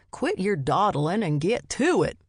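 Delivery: put-on a light Southern accent, boyfriend quoting grandma. A narrator's reading. Boyfriend teasing her, hinting at a Southern accent. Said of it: "His attempt at a southern accent".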